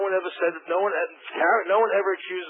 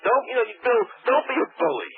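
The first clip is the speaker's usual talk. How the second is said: heated argument; 6 semitones higher than usual. Real, not acted. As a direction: push back hard at him. Guest protesting during a heated argument.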